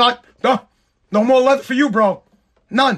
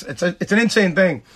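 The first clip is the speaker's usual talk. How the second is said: ordinary talk, nothing marked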